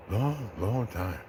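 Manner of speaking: lightly voiced Caesar, soft and slow, stretching each long; Caesar's slow reply. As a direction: drag out long, long as tired Caesar. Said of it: "Caesar asks".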